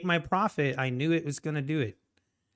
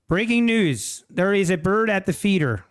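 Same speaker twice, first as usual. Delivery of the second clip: hammed-up breaking news, higher and louder; mock news anchor; 5 semitones higher, 6 dB louder than usual. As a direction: announce a bird like top news. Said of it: "mock news anchor bulletin".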